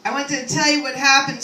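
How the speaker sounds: ordinary talk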